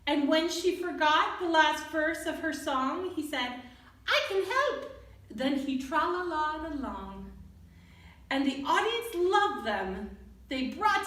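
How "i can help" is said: high little mouse offering help; squeaky mouse voice. Acted. Mouse helping the diva who forgot her song, high and eager. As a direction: pipe it up like a tiny mouse.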